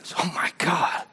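unvoiced shocked whisper. Real, not acted. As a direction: breathe it out in shock.

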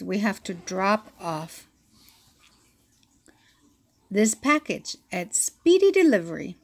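ordinary delivery

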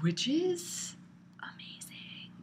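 whispered. Real, not acted. Hushed praise of pizza.

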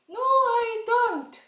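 very high, loud, drawn-out no; puppet child refusing. Pretend. Child puppet refusing vegetables, high and drawn out. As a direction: whine it out slowly like a stubborn kid.

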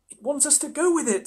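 put-on high-pitched girl explaining. A narrator's reading. Susan explains what the beaver wants, high girlish voice. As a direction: lift it into a young girl's voice.

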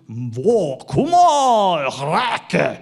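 loud, high, drawn-out "kuma" as a strange call; imitating the sounds. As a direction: call out "wo, kuma, rake" like the recorded creature. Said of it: "Boy, that word".

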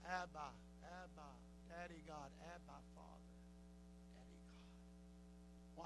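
soft, intimate plea to a father; almost breathe the words of longing; a hushed, tender prayer cry. Acted. Preacher voicing believers crying out.

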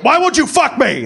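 high, loud yell into a bucket; hammed-up furious dad yelling. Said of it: "Heard him yell in the bucket".

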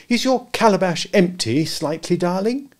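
high sweet mother voice; motherly voice. A narrator's reading. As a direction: coo like a fond mother. Mother voice to child.

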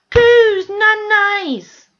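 high, loud cooing over a pet picture; mock cooing. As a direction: coo like a doting pet lover, mocking it. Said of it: "cats and dogs and going".

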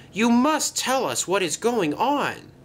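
high, insistent plea; crying out. A narrator's reading. Thomas pleading with the departing bus, high and insistent. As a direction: demand an answer, pitched high. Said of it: "cried Thomas".

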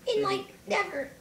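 high falsetto grumbling; squeaky sarcastic complaint, easing down at the end; high-pitched cartoon griping; ranting Link voice. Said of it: "Symbol Link comes out".

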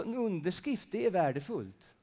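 ordinary talk, nothing marked

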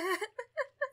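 high wheezy laugh; laughing sob. Real, not acted. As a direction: break into a desperate laugh. Laughing after scream.

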